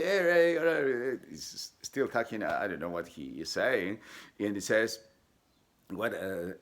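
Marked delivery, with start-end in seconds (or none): 0.0-1.2 high, loud calling hey; a kid shouting to get his attention. Retold or imitated. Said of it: "One of the kids show up and say".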